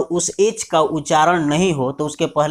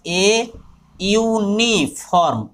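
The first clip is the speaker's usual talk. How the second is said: "Uniform" said slowly with a clear "yu" start; slow dictation; 3 semitones higher, much slower than usual. Shown as a demonstration. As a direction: stretch the word out.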